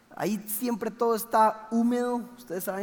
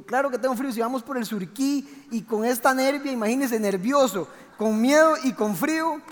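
agitated retort; 5 semitones higher, 5 dB louder than usual. Retold or imitated. Retold reply, frightened and cold.

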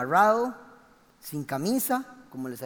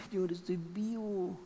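hushed prayer; 8 dB quieter than usual. Retold or imitated. Retold relieved prayer after escape.

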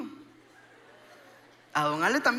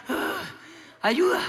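imitating gasping too hard to speak; gasp, then squeeze the word out; breathless; 5 semitones higher, slower than usual. Exhausted man reaching the boat, acted breathlessness. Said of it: "no podía ni hablar".

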